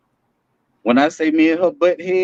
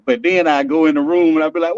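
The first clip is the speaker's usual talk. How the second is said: ordinary talk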